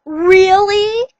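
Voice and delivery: a high, loud gasp of disbelief; shocked. A narrator's reading. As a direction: blurt it out, stunned. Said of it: "looked shocked with his mouth open".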